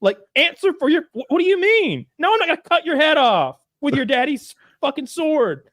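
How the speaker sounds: high, incredulous rant; hammed-up exasperated tirade; outraged Englishman. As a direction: refuse in flabbergasted fury, acted. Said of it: "He's like".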